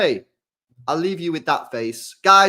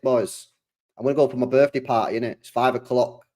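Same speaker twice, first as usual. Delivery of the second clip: normal talking voice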